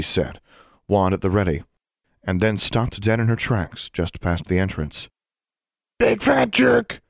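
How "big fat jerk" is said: very high, slow bird squawk; squawking parrot. Acted. Bird on her shoulder insulting Martin, squawked high. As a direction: screech it like a parrot. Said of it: "exclaimed Charlie".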